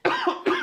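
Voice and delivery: sharp high cough; choking cough after swallowing wrong; coughing up water. Real, not acted. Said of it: "No, my, sorry".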